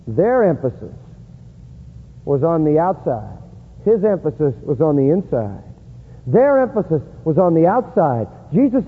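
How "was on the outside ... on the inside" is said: ordinary talk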